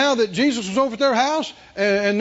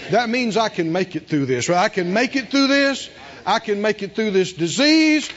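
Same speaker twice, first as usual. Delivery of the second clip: ordinary talk, nothing marked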